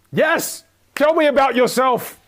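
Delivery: high, loud exclamation; excited confirmation. Real, not acted. Coach to the online class on the right answer, excited.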